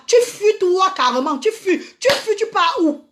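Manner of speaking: rising high and tearful on tu fuis; near-crying indignation at the men who run; indignant outburst. Real, not acted. Outraged rant at absent fathers. (indignation au bord des larmes)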